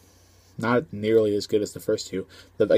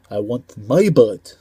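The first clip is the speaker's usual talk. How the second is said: slow, mock Russian accent, a bit louder; Mickey Rourke Russian accent; 7 dB louder, slower than usual. Reviewer quoting the Russian villain, slow mock Russian accent. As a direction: imitate the Russian villain, drawn out and heavy. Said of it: "poor Russian accent from Mickey Rourke".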